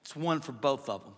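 normal talking voice

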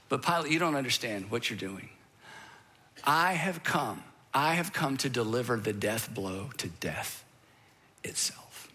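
a hint of Jesus, hushed and certain; solemn quiet Jesus. Jesus tells Pilate his purpose, hushed and solemn.

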